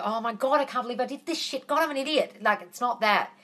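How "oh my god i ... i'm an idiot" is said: mock self-berating. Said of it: "it's hating on yourself".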